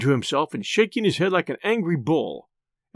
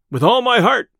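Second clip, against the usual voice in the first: high, loud and hearty, a cheerful challenge; merry cry; 6 semitones higher, 5 dB louder than usual. A narrator's reading. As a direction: call it out gladly, louder and higher. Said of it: "cried merry Robin".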